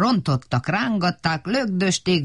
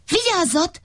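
calling warning; 10 semitones higher than usual. Acted. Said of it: "mondták".

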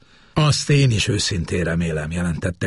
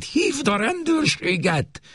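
much higher, slower urgent order; urgent repeat; slower than usual. Acted. Mother urgently repeats her order to her daughter, higher and slower. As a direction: press the order home. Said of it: "ismételte".

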